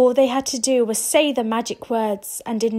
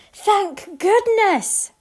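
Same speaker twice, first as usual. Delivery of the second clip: relieved sigh; 9 semitones higher, 3 dB louder, much slower than usual. Acted. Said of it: "said her mother".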